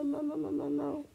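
ordinary talk

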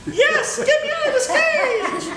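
high monkey voice breaking into laughter; giggling talking monkey. Acted. Monkey insisting on rescue, high voice with giggles. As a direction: play the monkey while cracking up.

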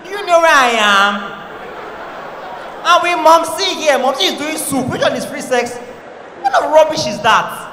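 mock outrage. Annoyed browser shouting at a pop-up ad with mum nearby, loud.

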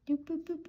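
quick high doop, boop, boop. Real, not acted. Tutor voicing each notch she draws with playful high boops. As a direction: tap each notch out with a high little sound.

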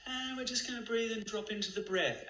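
high-pitched mock meditation guide; airy parody of a breathing cue, way up high; sing-song wellness-guru high voice.

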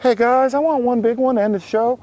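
high-pitched put-on voice, playful impersonation; Mike McKinnon. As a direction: pitch it way up, mimicking a show regular. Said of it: "I love that voice".

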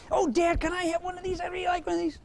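put-on very high, pleading kid voice; begging boy. Boy pleading with his dad, very high and wheedling.

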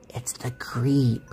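slow, frightened whisper. Retold or imitated. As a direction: hush it in terror. Recognising the caller.

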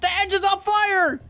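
high panicked cry; alarmed shout. Real, not acted. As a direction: yell it out in alarm. Game plane engine burning.

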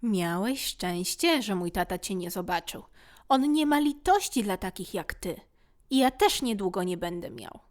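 plain reading voice

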